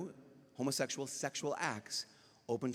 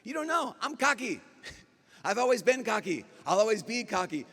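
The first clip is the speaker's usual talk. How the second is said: petulant protest; 9 semitones higher, 6 dB louder than usual. Acted. Defiant reply to Jesus, acted.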